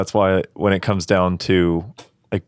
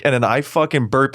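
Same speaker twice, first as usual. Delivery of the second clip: ordinary talk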